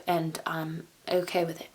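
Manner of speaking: whispered aside. Real, not acted. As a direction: confess it in a whisper. Aside about made-up accent.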